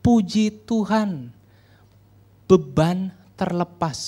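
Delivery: drawn-out, measured reading of a post; slowly quoted status update; slow recital. Retold or imitated. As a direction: stretch each phrase with pauses between. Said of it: "ada lagi status di Facebooknya".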